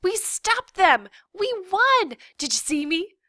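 put-on high, loud triumphant kid; jumping-up-and-down excitement; excited girl. A narrator's reading. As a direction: crow about the victory in a little girl's voice. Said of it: "Abby jumped up".

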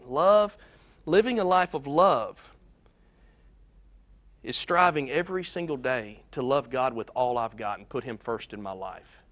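ordinary talk, nothing marked

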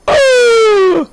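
high, loud shriek of fright; startled scream. Real, not acted. Player jumpscared in the woods, startled scream. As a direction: jump and scream. Sudden scare.

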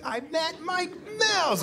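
very high, drawn-out fan squeal; starstruck excitement. Retold or imitated. Teenager who just met a rock star, overexcited squeal. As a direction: stretch it out high, thrilled to bits. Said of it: "I was so excited about it".

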